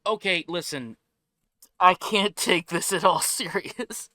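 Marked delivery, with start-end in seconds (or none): 1.8-4.1 giggling through the words; laughing. Real, not acted. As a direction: laugh while you talk. Laughing at trailer host.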